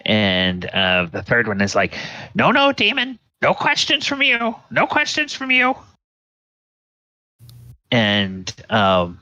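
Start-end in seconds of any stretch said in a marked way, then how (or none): 2.4-5.8 very high, squeaky refusal; shrieky character voice without shouting; scolding witch. Acted. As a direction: shut down the demon in a tiny high voice. Third woman refuses the demon's questions.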